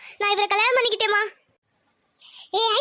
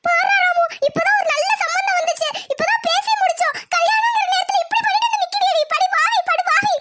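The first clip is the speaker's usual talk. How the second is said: angry lament; 7 semitones higher than usual. Acted. Mother complains to son about daughter's elopement, angry lament. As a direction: wail your outrage to your son.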